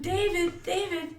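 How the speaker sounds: high worried calling; yelling across the room. Retold or imitated. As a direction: call his name through the house. Calling for lost child.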